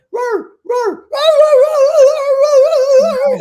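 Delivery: high, loud yowling dog; dog screaming. Retold or imitated. Dog panicking under the shock collar, loud high yowls. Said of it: "scream like that".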